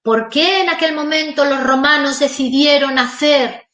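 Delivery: teacher posing a rhetorical question to students, questioning tune. Shown as a demonstration. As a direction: pose it as a rhetorical question to the class. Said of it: "¿A qué me refiero con esto".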